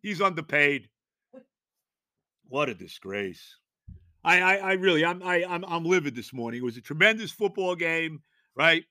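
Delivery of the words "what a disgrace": dropped low and slow; low disgusted mutter. Real, not acted. Fan muttering low about the officiating. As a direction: sink it in quiet disgust. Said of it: "low disgusted repeat".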